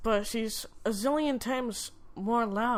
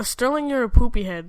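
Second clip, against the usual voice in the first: much louder playful shout; 12 dB louder, faster than usual. Real, not acted. Boy insulting Sterling on the host's request, loud. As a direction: yell the silly insult right at him. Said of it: "Call him that now".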